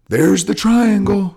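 high, loud, slow yelled callout; mock coach bellowing across the mats; mock sideline coach. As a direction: ham up a clueless coach calling the move. Mocking a yelling coach.

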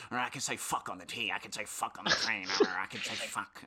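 lightly voiced belligerent ranter, repeating himself; a hint of a defiant grumbler answering back; imitating defiant man. Storyteller mimics the man defying the conductor, lightly belligerent. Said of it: "And he kinda, like".

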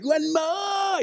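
pushy trainer. Retold or imitated. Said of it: "And they're like".

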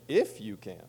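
"If" lifted sharply, then "you can" falling away; inflection on "If". Shown as a demonstration. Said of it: "That's where I put the inflection".

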